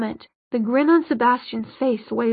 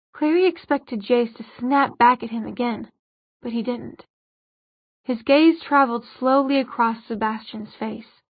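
ordinary delivery

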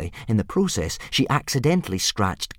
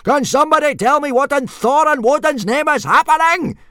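much higher, louder roaring rage; furious bellow; 6 dB louder than usual. Acted. Gobber raging at the boys and dragons, bellowing. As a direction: bellow at the whole field. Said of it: "went ballistic".

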